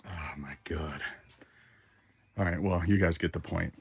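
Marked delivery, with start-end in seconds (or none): 0.1-1.0 shaken whisper; whispered aftershock. Real, not acted. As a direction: breathe it, still rattled. Still shaken.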